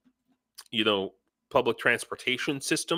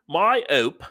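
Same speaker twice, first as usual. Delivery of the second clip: higher-pitched put-on Australian accent; 6 semitones higher, 4 dB louder than usual. Host playfully imitating an Australian viewer, Australian accent. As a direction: say it in Australian, dropping the H of hope. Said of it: "How was that for my Australian accent?".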